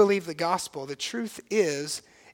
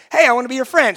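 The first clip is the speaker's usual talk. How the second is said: higher, louder, pretend eager approach; mock friendship offer; 5 semitones higher, 9 dB louder, much faster than usual. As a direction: play a pushy would-be friend.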